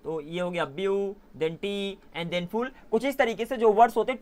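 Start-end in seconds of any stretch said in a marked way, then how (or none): 0.6-2.7 view, टी, फुल as three slow, separate pieces; syllable by syllable. Shown as a demonstration. Teacher showing three pieces to students, view, टी, फुल spaced apart. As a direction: count out view, then टी, then फुल, each on its own. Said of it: "ये तीन टुकड़ों में divide हो गया".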